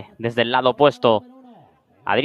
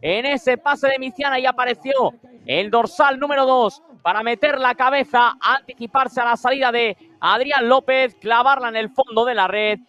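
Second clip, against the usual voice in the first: excited goal recap; 9 semitones higher than usual. Real, not acted. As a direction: keep the goal thrill in your voice. Goal recap right after.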